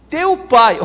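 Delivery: "Teu pai" high and loud; pointed TEU. Acted. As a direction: punch out "Teu pai", putting it on the other person. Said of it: "Olha a expressão dele".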